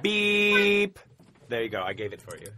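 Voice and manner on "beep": high loud beep; censor beep. Shown as a demonstration. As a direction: imitate a censor bleep. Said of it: "here, right now".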